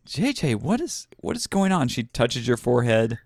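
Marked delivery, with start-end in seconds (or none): 0.1-1.9 lightly voiced nurse, higher pitch; a hint of the worried nurse, pitched up. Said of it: "she's like".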